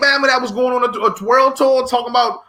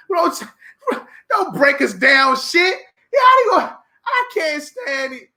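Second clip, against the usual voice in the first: mock anguished wail; 7 semitones higher than usual. Mock outrage over reparations.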